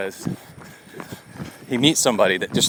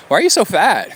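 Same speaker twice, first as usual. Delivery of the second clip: mock rude stranger; 8 semitones higher, 4 dB louder than usual. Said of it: "some stranger on the street says".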